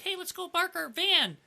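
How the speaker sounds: high, goofy mocking voice; dopey casual gamer. Mocking the casual players he expected to meet, high and goofy. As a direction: mimic a clueless newbie. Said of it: "Timmy's like".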